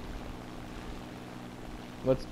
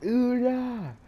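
much higher, slow mock battle cry; 9 semitones higher, much slower than usual. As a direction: cheer the comrades on, stretched out. Cheering comrades in game.

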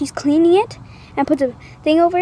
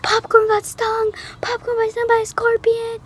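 retold scream; 8 semitones higher than usual. Child alerting everyone that her friend was stung, mock scream. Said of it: "I start screaming her name".

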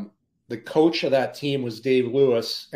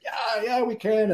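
higher, half-hearted yeah yeah; mock reluctant agreement; 9 semitones higher, 3 dB quieter than usual. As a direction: imitate teammates humoring him.

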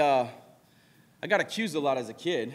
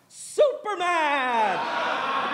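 high, very drawn-out cry; hammed-up, high-pitched fanfare; mock superhero taunt; 9 semitones higher, much slower than usual. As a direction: announce it like a caped superhero, stretched long. Sister's mock superhero taunt acted out.

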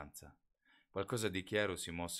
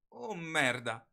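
sharp cursed protest, higher and louder; protesting curse; 9 semitones higher, 9 dB louder, much slower than usual. A narrator's reading. Bella, waking in Jacob's car, curses in protest. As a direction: spit it out, annoyed at yourself.

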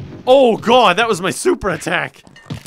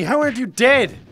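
ordinary talk

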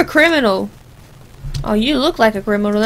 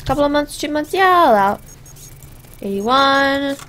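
ordinary talk, nothing marked

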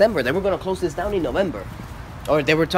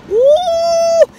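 high loud woo; excited whoop; 8 dB louder than usual. Retold or imitated. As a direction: cheer it out, way up high. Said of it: "I'm like".

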